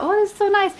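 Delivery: high, loud pleased coo; mock-swoon of pleasure; delighted. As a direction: gush over the lotion in a high voice. Acting out her own delight.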